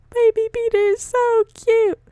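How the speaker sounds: very high cooing gush. Real, not acted. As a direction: squeal it with delight. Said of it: "gushing over the baby".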